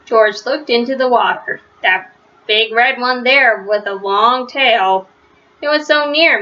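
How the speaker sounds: plain reading voice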